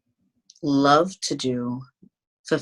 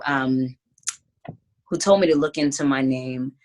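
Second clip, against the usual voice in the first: ordinary delivery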